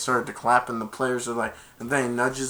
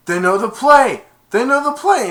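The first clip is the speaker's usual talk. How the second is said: high, loud panicked yell; alarmed shout; 11 semitones higher, 10 dB louder than usual. Retold or imitated. Kevin warning that the other team knows the play, loud. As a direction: sound the alarm. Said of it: "Kevin's like".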